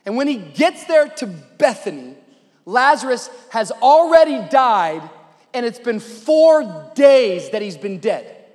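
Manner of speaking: rising preacher's narration; dramatic sermon storytelling. Real, not acted. Preacher setting the scene at Bethany, dramatic telling. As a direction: build the scene with punched, stretched key words.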